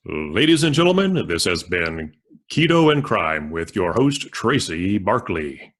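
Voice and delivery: booming, drawn-out boxing announcer; ring announcer voice. Shown as a demonstration. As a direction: announce it like a title fight. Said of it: "something in a ring announcer voice".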